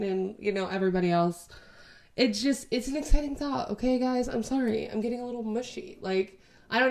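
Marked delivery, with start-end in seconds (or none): none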